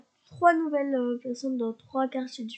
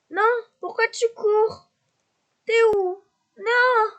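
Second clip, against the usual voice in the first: much higher, louder, desperate; frantic yelling; 10 semitones higher, 6 dB louder, slower than usual. Real, not acted. Streamer yelling at the neighbour running off, frantic. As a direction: cry out as he runs away. Chasing a fleeing Sim.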